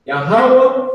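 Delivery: Yahweh said high and ringing out. Shown as a demonstration. Teacher proclaiming the Lord's name to class, high. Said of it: "here goes the slang".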